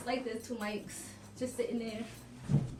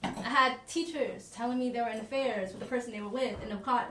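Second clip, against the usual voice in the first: normal talking voice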